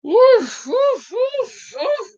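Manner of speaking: high yappy woofs, each one falling; playful puppy woofing; dog barks. Pretend. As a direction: bark like a little dog for fun. Barks playfully after laughing.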